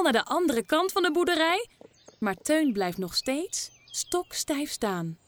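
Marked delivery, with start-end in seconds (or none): none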